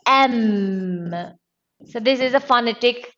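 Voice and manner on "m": "M" as a long, low "em"; vowel-sound demo. Shown as a demonstration. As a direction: stretch "M" from e into a hummed m. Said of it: "but the pronunciation".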